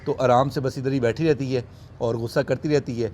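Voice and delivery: ordinary talk, nothing marked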